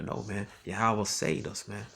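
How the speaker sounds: ordinary delivery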